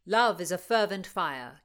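a loud, ringing opening line of verse; declaiming verse. Acted. Rutland reciting a love poem to Constance, loud and declamatory. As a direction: proclaim "Love is a fervent fire" like a showman.

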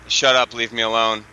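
usual speaking voice

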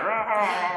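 short high shout of "Rah"; mock roar. Family at Christmas, a playful roar. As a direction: let out a quick playful roar. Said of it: "everyone's like".

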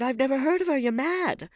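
high, loud panicked protest; panicked denial. A narrator's reading. Accused man denies in panic.

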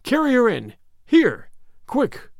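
high, urgent orders; pressing cry to get everyone inside; shouted. A narrator's reading. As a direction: call out hurried commands. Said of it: "Harvey Deacon shouted".

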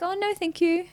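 high, soft polite decline; polite refusal. Retold or imitated. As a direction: politely brush her off. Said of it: "I'd be like".

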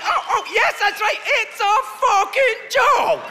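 very high, loud mock-Canadian delight; delighted mock shout. Comic playing Canadians who get the joke, delighted high shout. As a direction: shout it high, stretching out the last phrase.

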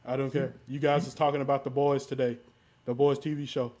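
ordinary talk, nothing marked

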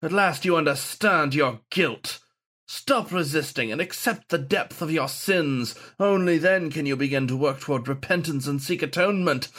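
high, sermonizing zealot; pompous ghost saint. A narrator's reading. Haunting saint preaching guilt at his descendant. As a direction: lecture him on his sins.

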